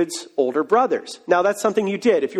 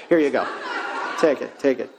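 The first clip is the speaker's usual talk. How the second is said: laughing dad; 7 semitones lower, faster than usual. Retold or imitated. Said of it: "the dad sends him, he says".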